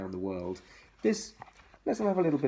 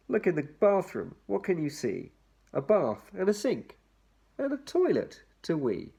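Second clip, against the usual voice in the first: bright sing-song picture-book read, higher; lilting read-aloud for little ones; reading to children; 7 semitones higher than usual. Acted.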